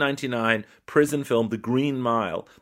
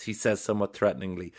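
ordinary talk, nothing marked

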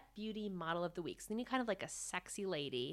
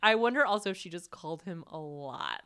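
ordinary talk, nothing marked

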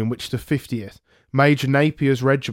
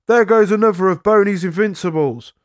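higher, louder mocking battle call; soldiers' jeering shout; 6 semitones higher, 3 dB louder than usual. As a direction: call it out across the field at the enemy. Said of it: "our men called out".

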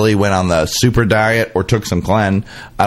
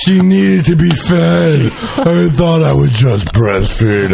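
mock Demi voice; 3 semitones higher than usual. Voicing Demi Moore.

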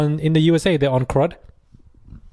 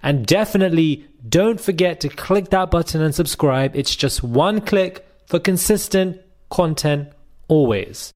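upbeat presenter read, slowed and stretched out; intro host voice; 4 semitones higher, slower than usual. Acted. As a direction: announce the show like a polished host.

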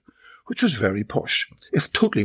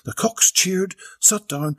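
plain reading voice

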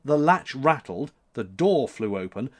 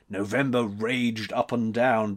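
ordinary delivery

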